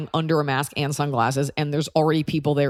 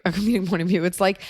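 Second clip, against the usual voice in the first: normal talking voice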